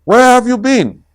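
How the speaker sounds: high, loud and fast; fast, loud, high tiger question; excited question. Acted. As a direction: fire it off with bouncy energy. Tigger asking loudly.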